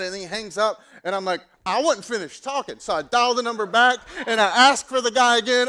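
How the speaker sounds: loud, high, heated rant; fired-up retelling. Retold or imitated. Preacher retelling his call to a scammer, fired up. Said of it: "I was so fired up".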